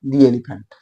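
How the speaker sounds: दी elephant, "दी" as a clear long dee; "dee" before elephant. Shown as a demonstration. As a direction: model दी elephant for the class. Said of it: "यहाँ दी पढ़ा जाएगा". ("दी elephant" साफ उच्चारण)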